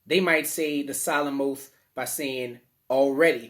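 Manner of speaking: ordinary talk, nothing marked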